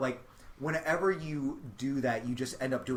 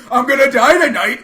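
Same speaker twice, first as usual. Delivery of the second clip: loud, higher, hammed-up wail; mock dramatic yell; 9 semitones higher, 17 dB louder than usual. Comic chugging whiskey onstage, melodramatic loud yell. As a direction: bellow it like a melodramatic doom. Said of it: "I was like".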